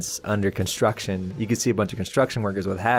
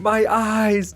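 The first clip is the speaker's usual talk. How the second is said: mock scream; 11 semitones higher than usual. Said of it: "you could hear when a woman scream".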